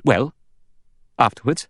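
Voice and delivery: hard, questioning tone. A narrator's reading. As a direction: press for the rest with a hard edge. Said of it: "In a hard voice".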